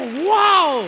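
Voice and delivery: loud, drawn-out wow of amazement; amazed wow. Retold or imitated. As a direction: belt out her astonishment to the congregation. Retelling her amazement at the miracle.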